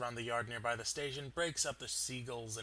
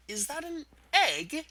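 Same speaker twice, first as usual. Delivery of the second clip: slow, much higher, louder disbelief; astounded; 10 dB louder than usual. Acted. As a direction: stare at it, utterly stunned.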